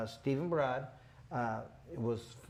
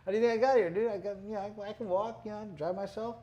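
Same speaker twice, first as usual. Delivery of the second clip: higher, amused brush-off with a laugh; laughing retort; 5 semitones higher than usual. Retold or imitated. Boxer answering his amazed doctor, laughing and amused. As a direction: laugh it off like it's obvious.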